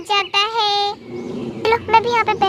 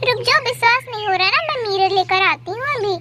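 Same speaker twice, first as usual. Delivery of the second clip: ordinary delivery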